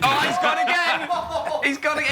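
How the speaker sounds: high, laughing call of a wicket; excited commentary. Pretend. Commentator crowing as Lammy is bowled again, high and laughing. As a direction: crow it out, delighted.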